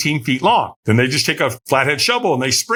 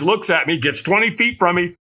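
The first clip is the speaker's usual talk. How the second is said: normal talking voice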